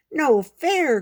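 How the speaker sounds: high, loud protesting cry; cried out. Acted. Pip crying out at his brother, loud and high. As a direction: cry it out like a cheated little brother. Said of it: "cried Pip".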